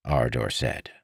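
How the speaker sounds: plain reading voice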